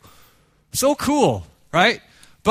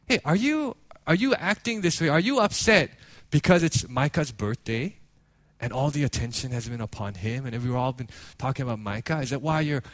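softening, gently questioning; sit a child down and ask tenderly, voice sinking; retold gentle father; 5 semitones lower, 5 dB quieter than usual. Father gently asking his upset daughter, softening voice. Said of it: "sat her down and was like".

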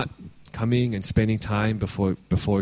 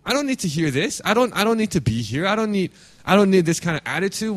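much higher, fast indignant mock protest; offended listener; 7 semitones higher, faster than usual. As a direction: huff like a churchgoer insulted from the pulpit. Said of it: "We're gonna be like".

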